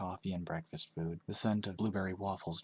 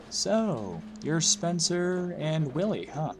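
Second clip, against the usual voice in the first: high, slow, chatty woman's voice; friendly bus driver; 9 semitones higher, 5 dB louder, much slower than usual. A narrator's reading. Bus driver greeting the new kids, high and slow.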